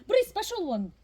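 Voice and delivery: sharp high shout at the cat; shooing a cat. Real, not acted. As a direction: chase the cat off loudly.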